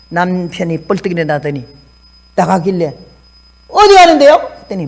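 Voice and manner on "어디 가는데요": higher, louder startled question; retold own question. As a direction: call after him, alarmed. Asking husband where going. (높고 큰 놀란 물음)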